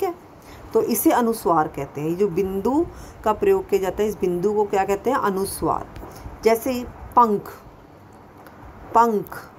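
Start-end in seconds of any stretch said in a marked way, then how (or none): 6.4-9.5 पंख said slowly with a nasal ं before ख; पंख with a clearly sounded nasal ं; clear anusvara. Shown as a demonstration. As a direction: stretch पंख so the nasal ं is heard. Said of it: "अब ये शब्द बोला".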